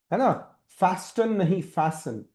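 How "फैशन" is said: usual speaking voice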